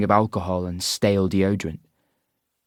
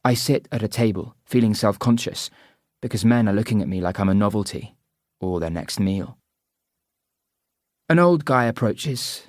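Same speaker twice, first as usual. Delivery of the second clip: usual speaking voice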